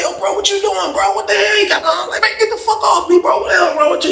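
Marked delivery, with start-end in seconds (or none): 0.0-2.0 very high-pitched, incredulous reenactment; alarmed protest. Retold or imitated. Man grabbed in bed by his cellmate, high alarmed protest. 2.2-4.1 very high-pitched mock panic; agitated protest. Man pushing off an overexcited cellmate, high agitated protest.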